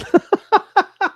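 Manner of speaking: sudden high, loud laugh; burst of laughter. Real, not acted. Streamer laughing out loud at a chat question. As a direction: let a real laugh burst out.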